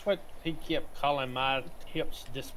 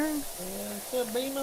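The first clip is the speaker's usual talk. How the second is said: pet voice; 10 semitones higher, slower than usual. Real, not acted. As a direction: coo at the pet slowly. Greeting the pet.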